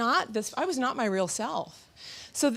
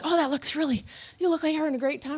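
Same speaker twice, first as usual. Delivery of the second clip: imitating onlookers; 7 semitones higher, faster than usual. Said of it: "they're like".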